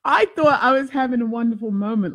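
ordinary talk, nothing marked

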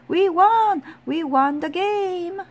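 high, loud victory yell. A narrator's reading. Tom celebrating the surprise win, jubilant high yell. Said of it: "Then Tom yelled".

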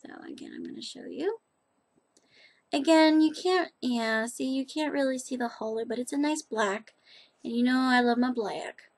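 ordinary talk, nothing marked

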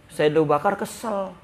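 normal talking voice

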